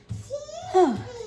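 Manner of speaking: loud, heavy sigh from exertion; out-of-breath sigh. Real, not acted. Cook winded from going up and down steps, heavy sigh. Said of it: "I'm out of breath".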